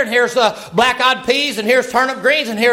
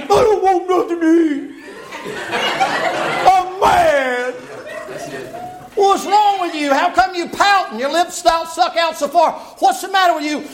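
put-on high, childish pouting whine; high pouting king, near tears at the end; whiny sulking king; 10 semitones higher than usual. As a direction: sulk like a spoiled boy denied a toy. Ahab sulks like a child.

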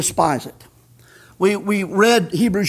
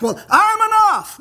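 mock proud voice; 10 semitones higher than usual. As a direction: shout it in a puffed-up mock voice. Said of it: "For you to think".

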